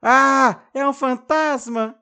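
high, loud terrified cry; high scared shout; frightened scream. Acted. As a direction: shriek at the ghost. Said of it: "corre gritando".

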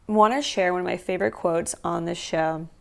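ordinary talk, nothing marked

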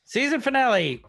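high, loud title call; title announcement. Real, not acted. Podcast host echoing co-host's title, announcing it loudly. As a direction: belt out the title like an intro.

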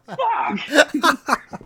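high laughing fuck; laughing curse. Retold or imitated. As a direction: blurt it through a laugh. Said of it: "I was like".

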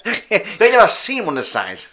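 high, loud, slow dodgy showman; sketchy circus barker. Acted. Rizzo brother boasting to the crowd, sketchy barker voice. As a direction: brag slowly through the laughter. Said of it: "why they've got that accent".

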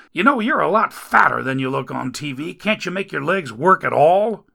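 grouse while getting him up; grumbling rescuer. Acted. Rescuer complains over injured Dagon, loud grumbling.

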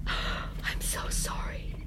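slow, breathless whisper; broken whisper. A narrator's reading. Medea, spent, whispers her apology. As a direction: let it die out in a whisper.

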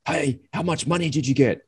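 a lightly voiced nosy bloke; curious soldier. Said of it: "This guy stopped me and he goes".

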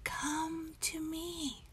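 high, slow, soft tree whisper. Acted. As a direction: call softly like a beckoning tree. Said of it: "you can hear them saying".

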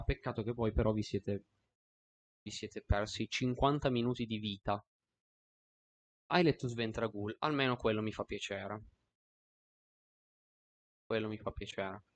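normal talking voice

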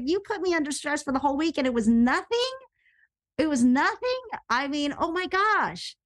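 high, incredulous retold protest; stunned, rising pitch on the key word; shocked disbelief. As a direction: question it as if you can't believe it. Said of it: "and so shocked".

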